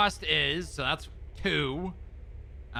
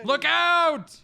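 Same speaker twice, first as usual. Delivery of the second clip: sharp yell of alarm, much higher and louder; shouted warning; 13 semitones higher, 8 dB louder than usual. Real, not acted. Driver yelling at partner to look out. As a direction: yell it to stop the crash. Sudden danger while driving.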